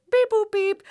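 light high sing-song beep boop beep; casual beeps. Retold or imitated. Boyfriend casually tapping in the alarm code, sing-song beeps. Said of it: "He just goes".

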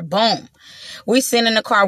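normal talking voice

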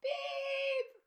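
squeaky high-pitched beep; high beep sound. Pretend. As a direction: chirp it like a censor beep. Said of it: "recordings of us just going".

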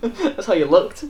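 teasing through laughter, slowed down; amused teasing. Real, not acted. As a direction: laugh as you tease your friend. Laughing at friend's carpet remark.

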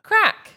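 high, loud, crisp Crack; correct pronunciation. Shown as a demonstration. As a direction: Crack said like "crack", short a. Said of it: "It's an Irish word".

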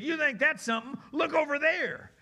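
high, loud astonished townsperson; excited bystander. Acted. Townsperson points out the healed man, excited call.

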